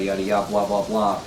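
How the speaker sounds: ordinary delivery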